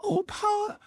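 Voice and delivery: put-on very high frightened child's cry. Little boy cries out as his father comes at him, very high. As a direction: plead in terror. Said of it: "The little boy cried".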